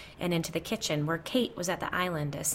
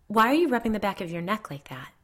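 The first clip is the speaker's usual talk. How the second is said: higher, louder question cutting in; abrupt, raised question; interrupting; 4 semitones higher, 6 dB louder than usual. A narrator's reading. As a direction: break in with urgent concern. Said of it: "Kate interrupted".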